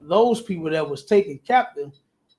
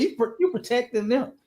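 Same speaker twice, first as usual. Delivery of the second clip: ordinary talk, nothing marked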